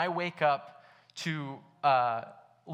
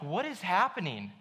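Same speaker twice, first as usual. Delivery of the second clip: mild mock yell, higher-pitched; retold scream; 4 semitones higher than usual. Passenger confronting his reckless friend, mock exasperated yell. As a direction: retell an alarmed outburst without really shouting. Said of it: "I'm, like, screaming at him".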